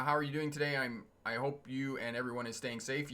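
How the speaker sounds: ordinary delivery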